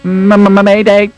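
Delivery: loud high stuttered mayday; panicked stammer. Acted. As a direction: cry out in panic. Plane crashing, panic call.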